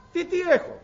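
high indignant Τι, τι έχω; incredulous, voice jumping up; exasperated woman. Acted. Hagar snapping back at God, high and indignant.

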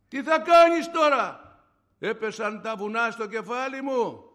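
high, slow, loud outcry; loud rhetorical question, pitched high; dramatized alarm. Retold or imitated. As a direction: cry it out to the congregation, drawn out. Dramatized reaction to the diagnosis.

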